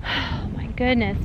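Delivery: usual speaking voice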